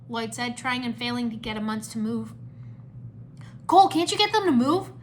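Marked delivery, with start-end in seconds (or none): 3.7-4.9 high, loud frustrated question; urgent call. A narrator's reading. Lloyd begs Cole's help mid-battle, urgent shout. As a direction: call out over the chaos. Ninja calling over battle.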